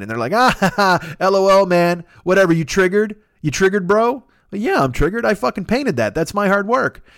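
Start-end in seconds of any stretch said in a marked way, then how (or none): 0.3-4.2 higher, louder jeering troll imitation; mock-laughing bro, pitched up; mocking taunt. Imitates mocking online art thieves. 4.5-7.0 high-pitched protest of a wronged artist; wounded artist firing back, pitched up; mock indignation.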